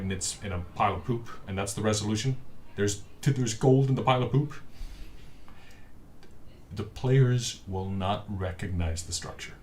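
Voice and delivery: ordinary talk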